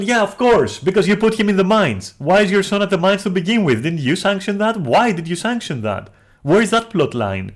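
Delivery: high, loud, rapid-fire challenge; frustrated yelling. Real, not acted. Arguing back at chainsaw lady over the mines, yelling. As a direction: yell back in exasperated disbelief. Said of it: "growling is just, like, frustration".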